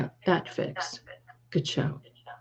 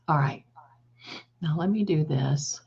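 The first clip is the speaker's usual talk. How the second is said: normal talking voice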